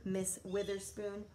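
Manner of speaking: usual speaking voice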